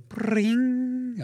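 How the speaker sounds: high, held mock chime; imitated jingle sound. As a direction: stand in for the missing sound drop with a high ring. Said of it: "I don't have the drop for it".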